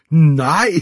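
high, loud cry of delight; incredulous shout. A narrator's reading. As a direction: shout it out in amazed disbelief. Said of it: "krummede sig sammen af latter og råbte". (højt, vantro råb)

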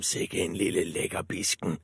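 soft greedy wolf aside. A narrator's reading. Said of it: "tænkte ulven".

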